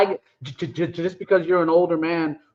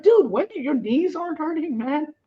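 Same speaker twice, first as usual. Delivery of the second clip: much higher, amazed question; mock incredulous friend; 9 semitones higher than usual. Asking tired friends about knees, high mock amazement. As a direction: squeak it out in disbelief.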